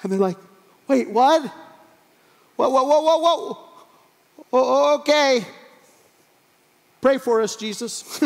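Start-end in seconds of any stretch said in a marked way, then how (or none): 0.9-5.4 high, loud comic panic; hammed-up startled whoas, then a wobbly acceptance; alarmed confusion. Disciples suddenly pushed out to sea, alarmed confusion. 7.0-8.2 higher, lightly acted call; mock-worried plea. Disciples drifting away from Jesus, mock-worried plea.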